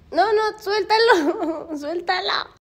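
high, drawn-out plea; pleading. Real, not acted. Woman telling dog to let go, laughing plea. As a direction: beg slowly in a high whine with a laugh.

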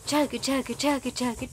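low, even rhythmic chugging; train chugging imitation. Shown as a demonstration. Showing a toddler a train sound, steady low chug. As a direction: chug along like train wheels. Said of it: "Can you do that?".

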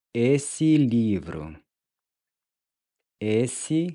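ordinary talk

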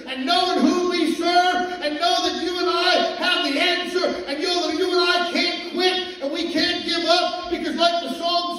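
loud, building pulpit exhortation; fiery preaching. Acted. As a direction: rouse the congregation to hold on. Preaching to the congregation.

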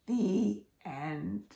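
ordinary delivery, nothing marked

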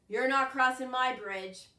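troll refusing to let the goat cross; gruff troll. Acted. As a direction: block the way as a bossy troll.

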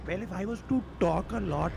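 tentative go at an Indian accent; Indian accent attempt. Shown as a demonstration. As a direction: put on an Indian accent on request. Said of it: "can you do an Indian accent".

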